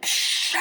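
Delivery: long, hard hissing whoosh; imitated flamethrower. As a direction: make a flamethrower blast with your breath. Imitates flamethrower lighting him.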